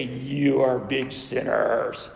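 low, slow, booming accusation; calling people out. Acted. Preacher imitating Jesus calling out sinners, condemning. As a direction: condemn them in a deep put-on voice. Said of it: "calling people out as if to say".

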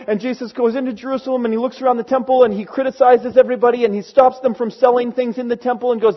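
ordinary talk, nothing marked

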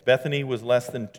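ordinary talk

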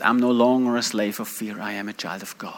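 low, soft recitation of a song line; quiet, deep-pitched comfort to oneself; hushed recited lyric. Retold or imitated. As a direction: murmur the lyric low, fading almost away. Recalls the song in his ear.

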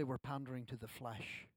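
normal talking voice

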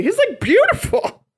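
high, swooning praise. Real, not acted. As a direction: swoon over the actor. Gushing over Cary Elwes.

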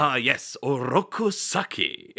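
put-on slow, grand Japanese mid-Atlantic accent; lightly hammed Japanese accent with old-Hollywood polish. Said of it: "mixture of a Japanese accent and then, like, just the highbrow mid-Atlantic accent".

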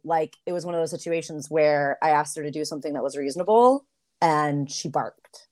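ordinary talk

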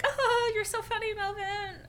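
high-pitched, phony amusement; fake laughing. Serena pretending Melvin is funny, fake-amused. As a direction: put on an exaggeratedly false delight, way up high. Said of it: "fake laughs to be like".

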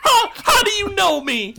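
very high, wailing orc voice; startled orc. Acted. Orc recognized by a player, high startled cry.

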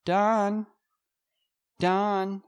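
high calling of the name from offstage; from another room. Acted. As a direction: call out from the next room. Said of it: "Maggie, off screen".

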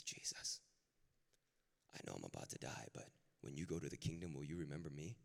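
soft, near-whispered dying plea; hushed plea. Retold or imitated. Thief on the cross begs Jesus to remember him, hushed plea. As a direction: ask it softly, barely above a breath.

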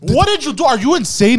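high, loud, panicked questions; freaking out. Retold or imitated. Yelling at his girlfriend about the stranger, high and loud. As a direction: shout at her in alarm.

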